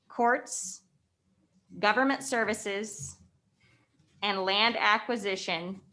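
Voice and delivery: slowly, with long pauses between items; slow dictation. Real, not acted. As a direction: read it out item by item for note-takers. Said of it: "I'll say it slowly".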